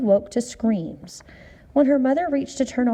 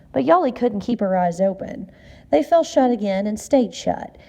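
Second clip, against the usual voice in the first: usual speaking voice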